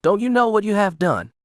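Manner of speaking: a touch of anguished anger; emotional outburst. A narrator's reading. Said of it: "her voice thick with emotion".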